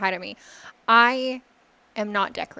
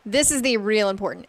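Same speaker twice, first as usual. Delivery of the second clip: ordinary delivery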